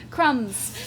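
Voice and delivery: attempted Cockney "crumbs"; playful go at Cockney; Cockney accent. Shown as a demonstration. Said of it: "I was better at the cock-, Cockney accent".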